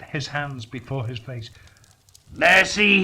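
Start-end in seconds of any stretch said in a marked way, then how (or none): 2.3-3.0 loud, high cry for mercy; terrified plea. Acted. Scrooge begging the shrieking ghost, loud terrified plea. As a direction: beg in terror.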